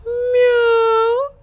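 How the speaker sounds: high meow; cat meow. A narrator's reading. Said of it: "сказала кошка".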